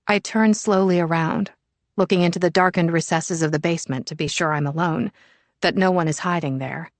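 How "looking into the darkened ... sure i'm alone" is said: ordinary delivery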